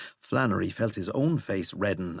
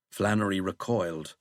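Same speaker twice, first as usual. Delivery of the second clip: ordinary delivery, nothing marked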